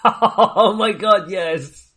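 high, slow, laughing oh my God; cracking up in disbelief. Real, not acted. Co-host hears the casting news, laughs in disbelief.

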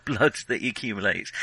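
ordinary talk

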